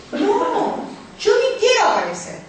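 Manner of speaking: high, emphatic mock protest; acted refusal. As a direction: play a husband flatly refusing to go. Acted husband refusing again. (negativa rotunda actuada, en tono agudo)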